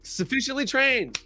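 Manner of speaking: high, slow, smug sign-off; mock self-satisfied trainer; satisfied boss. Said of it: "And we go".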